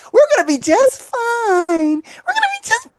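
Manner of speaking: high wobbly mock sob; fake-cry the reassurance; hammed-up tearful high voice; mock crying girlfriend.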